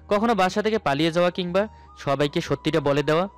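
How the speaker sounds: plain reading voice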